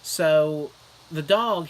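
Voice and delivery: usual speaking voice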